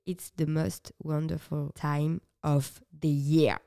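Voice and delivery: very slow English with a French accent; painstaking French-accented English, word by word; shaky French-accented English. Shown as a demonstration. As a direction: stretch out the English title, French accent showing. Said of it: "je mise pas sur mon accent anglais".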